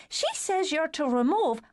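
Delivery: put-on high, girlish voice; light teen girl voice; little sister voice. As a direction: play the cheeky youngest sister. Alice May relaying again.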